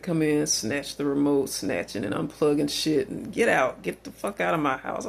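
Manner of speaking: normal talking voice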